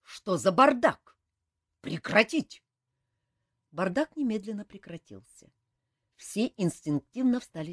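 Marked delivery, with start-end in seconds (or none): 0.0-2.6 barked order. A narrator's reading. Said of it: "рявкнул полковник Сур".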